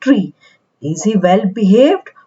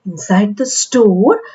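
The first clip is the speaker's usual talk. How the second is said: plain reading voice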